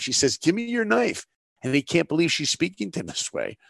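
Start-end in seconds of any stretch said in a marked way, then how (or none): 0.4-1.3 high-pitched mock demand, half acted; retold demand. Mother retelling her demand to the Nazi, mock sharp and high. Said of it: "demands his knife".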